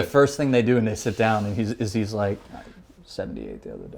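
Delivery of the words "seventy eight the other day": very low, soft confiding aside; hushed imitation. Politician to rival at funeral, hushed aside. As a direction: drop right down as if sharing privately. Imitating powerful man discussing golf score.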